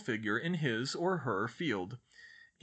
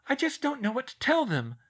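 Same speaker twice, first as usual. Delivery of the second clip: high, louder distressed outburst; spilling out; 12 semitones higher, 6 dB louder than usual. A narrator's reading. Schoolgirl confides about her parents, words spilling out. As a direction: let the worry burst out. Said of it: "the words came spilling out".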